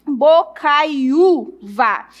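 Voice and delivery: very slow "bocaiúva", broken into syllables; "bocaiúva" stretched out slowly for a class; syllable-by-syllable demonstration. Shown as a demonstration. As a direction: separate each syllable of "bocaiúva", lengthening the ú. Teacher separates syllables while writing.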